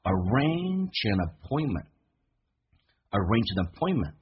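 slow arrange an appointment, words linked, T sounds dropped; 慢速连读arrange an appointment; linked phrase. Shown as a demonstration. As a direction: glide arrange an appointment together slowly. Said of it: "三个单词叫".

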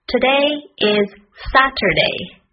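"Today is Saturday" word by word. Shown as a demonstration. Teacher models sentence slowly first.

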